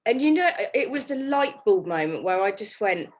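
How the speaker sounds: usual speaking voice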